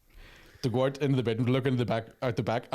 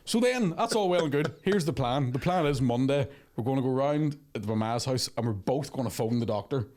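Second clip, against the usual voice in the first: ordinary delivery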